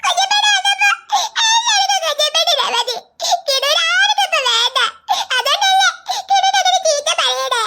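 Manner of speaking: put-on little boy crying because nobody wants him; crying child. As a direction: whimper it like a hurt toddler. Child complains tearfully.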